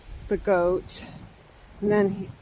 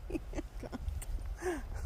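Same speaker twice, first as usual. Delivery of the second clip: nervous breathy laugh; 8 semitones higher, 15 dB quieter than usual. Real, not acted. Goat keeps coming closer.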